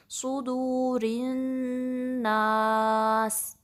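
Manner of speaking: very slow सुदूरिन्नास with held nasal ghunna; slow recitation demo. Shown as a demonstration. Teacher to students, demonstrating ghunna on noon. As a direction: draw out सुदूरिन्नास, humming the nn. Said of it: "तो आप इस तरीके से पढ़ेंगे".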